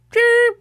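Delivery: high sharp beep; imitated beep. Host imitating the smoke detector chirp, high beep.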